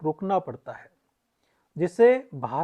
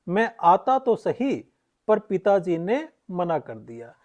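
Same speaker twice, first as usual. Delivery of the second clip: slow "मैं आता तो सही", then a break before "पर"; semicolon pause after सही. Shown as a demonstration. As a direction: hold a little longer than a comma after "सही". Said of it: "अल्प विराम से थोड़ा ज्यादा ठहरे". ("सही" के बाद अर्ध विराम)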